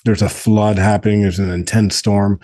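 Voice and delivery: ordinary talk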